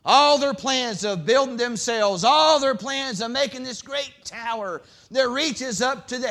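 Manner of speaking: loud, high preaching voice; full-force pulpit preaching. Acted. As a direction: preach it hard to the congregation.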